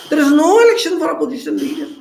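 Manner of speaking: fervent fast proclamation; emphatic declaration. Real, not acted. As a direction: hammer the fact home to the audience. Speaker lecturing crowd emphatically.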